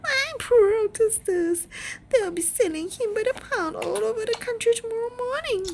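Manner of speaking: very high, fast tearful wail; mother wailing over her son's fate. Acted. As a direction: grief rushing out in a torrent. Mother wailing after scream.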